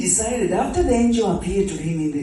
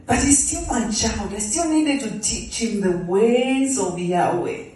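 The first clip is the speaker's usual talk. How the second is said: normal talking voice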